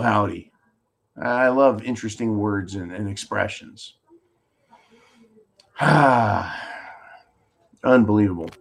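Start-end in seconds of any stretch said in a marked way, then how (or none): 5.8-6.7 long, audible exhale; exasperated sigh. Real, not acted. Host fed up with the news, long exasperated sigh. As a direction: let out a heavy sigh. Exasperated pause before repeating unbelievable.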